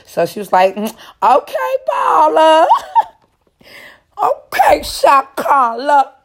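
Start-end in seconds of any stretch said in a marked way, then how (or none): 0.8-2.8 high, loud, drawn-out hype; playfully impressed squeal; laughing tease. Acted. She teases him as rich. 4.2-6.1 high, stretched-out playful cheer; mock-impressed, pitched way up; teasing hype. Teasing continues.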